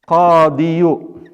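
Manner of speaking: qadiyu with the full ending, each syllable marked; heavy form. Shown as a demonstration. Teacher showing students the full heavy word form.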